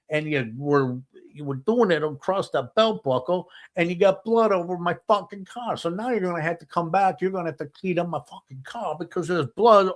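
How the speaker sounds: high-pitched, worked-up scolding; exasperated rant. Retold or imitated. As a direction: chew him out like an aggrieved buddy. Buddy rants about bloodied car.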